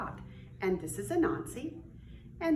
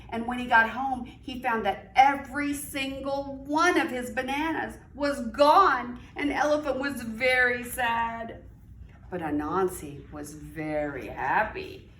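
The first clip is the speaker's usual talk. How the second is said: lively storybook read-aloud; reading to children; 6 dB louder than usual. A narrator's reading. Teacher reading aloud to young children, animated storytelling. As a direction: read it like story time for little kids.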